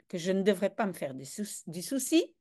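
ordinary delivery

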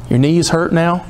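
usual speaking voice

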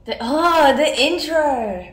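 slow, loud, booming intro announcement; dramatic announcer. Pretend. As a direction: drag it out like a movie trailer voice. Dramatic retake of intro line.

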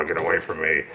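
low, soft groggy grumble; a barely awake get away from me; grumpy morning voice. Retold or imitated. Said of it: "A little like".

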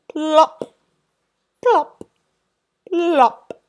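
loud, high plops; comic plops. A narrator's reading. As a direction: pop each one out. Sound effect of landings.

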